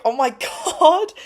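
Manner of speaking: high, loud, laughing oh my god; laughing disbelief. Retold or imitated. Me laughing at her reaction, high and loud. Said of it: "And I was like".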